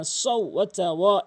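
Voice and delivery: ordinary talk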